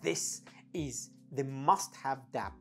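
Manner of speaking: usual speaking voice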